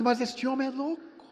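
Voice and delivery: a startled thought that this man is mad; incredulous. Retold or imitated. Incredulous inner reaction. (espanto incrédulo, voz mais aguda)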